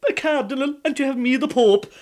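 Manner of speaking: high, loud desperate protest; beg, blame the wife; pleading husband. Acted.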